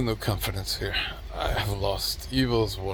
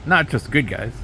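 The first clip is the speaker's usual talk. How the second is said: ordinary talk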